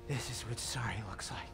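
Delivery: quiet icy rejection; cold and quiet. Acted. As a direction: drop it low and cold.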